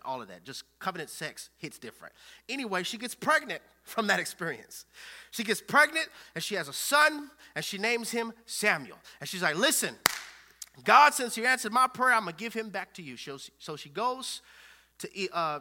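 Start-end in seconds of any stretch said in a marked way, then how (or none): 2.5-13.2 raised, high-pitched preaching voice. Real, not acted. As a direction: tell it loud and emphatic.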